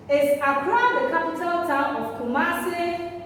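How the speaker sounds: teacher's demo question with "Accra" lifted; question intonation. Shown as a demonstration. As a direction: punch "Accra" high to show you are asking. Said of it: "actually asking a question".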